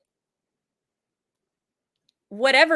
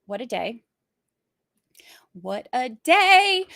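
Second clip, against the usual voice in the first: put-on slow, drawn-out Southern accent; exaggerated Southern accent; slower than usual. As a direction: stretch the vowels in a mock Southern drawl. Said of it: "I'm getting good at my accent".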